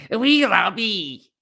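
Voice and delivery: playful Scottish voice for the poet; Scottish accent. Shown as a demonstration. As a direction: lay on a thick Scottish brogue. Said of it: "That's my pure Scottish voice".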